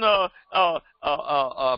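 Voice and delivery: ordinary talk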